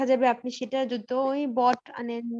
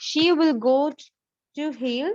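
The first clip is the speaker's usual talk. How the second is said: repeated dictation; 3 dB louder, much slower than usual. Shown as a demonstration. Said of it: "আবার বলবেন?".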